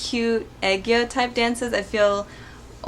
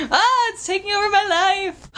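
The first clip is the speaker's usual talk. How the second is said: mock despair; 11 semitones higher, 3 dB louder than usual. Mock despair over the accent.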